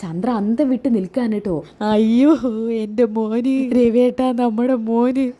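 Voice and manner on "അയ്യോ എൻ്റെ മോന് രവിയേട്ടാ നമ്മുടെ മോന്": slow, drawn-out mother's wail; mock sobbing lament; acted wailing. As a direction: cry out for the son in hammed-up grief. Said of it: "നീ കരയാതിരിക്കെ".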